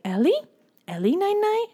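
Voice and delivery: put-on high, questioning baby talk; toddler voice. Toddler pointing at sister's bed before nap, plaintive little question. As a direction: imitate a tiny child asking, pitch climbing high. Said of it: "and she would say".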